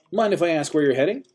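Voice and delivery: put-on high, loud chatty old-timer; busybody old man. A narrator's reading. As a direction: chatter on, relentlessly cheerful.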